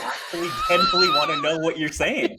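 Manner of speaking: high, laughing mock confusion; retelling a friend's baffled reply; laughing disbelief. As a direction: voice lifting into laughter.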